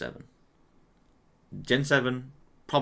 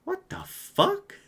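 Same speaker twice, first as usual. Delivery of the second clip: high, slow baffled cry; high drawn-out bafflement; startled; 11 semitones higher, slower than usual. Real, not acted. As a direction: squeal out the disbelief slowly. Guessing cries, startled.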